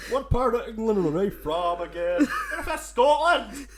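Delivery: high, slow mock Scottish accent; hammed-up Scottish accent. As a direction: belt it out in an exaggerated Scottish accent. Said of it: "you couldn't hear through my flawless accent".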